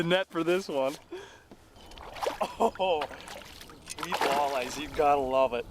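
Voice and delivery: can't believe the fight this fish put up; thrilled. Real, not acted. Landing a monster walleye.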